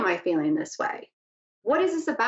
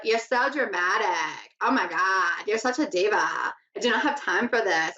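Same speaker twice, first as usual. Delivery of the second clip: bad Anna Delvey accent; 3 semitones lower than usual. Host quoting a TV show character, terrible Anna Delvey accent. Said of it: "I'm terrible at accents".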